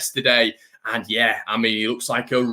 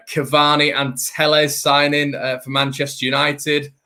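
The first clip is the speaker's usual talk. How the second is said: ordinary talk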